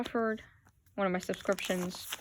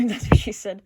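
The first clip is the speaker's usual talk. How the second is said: laughing at own joke, louder; loud, amused burst with a laugh; 9 dB louder than usual. Real, not acted. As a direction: crack up on the punchline.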